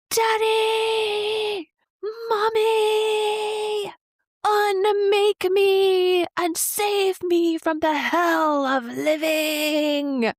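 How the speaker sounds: high, loud, very slow tormented shriek; Longlegs shriek impression. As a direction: wail it out like a man begging to be unmade. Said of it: "You did a great i-, impression of it".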